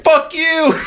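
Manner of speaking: high, laughing mock yell; a hammed-up curse at the driver. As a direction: yell it back through a laugh. Retold yelling back at driver.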